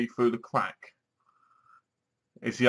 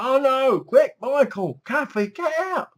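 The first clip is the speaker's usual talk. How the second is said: much higher urgent mock cry; alarmed viewer; 11 semitones higher than usual. Viewer pretending to warn the characters, higher and urgent. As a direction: yell a warning at the screen. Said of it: "And you're like".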